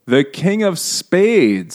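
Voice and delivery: mock caption. Mocking the racist photo captions.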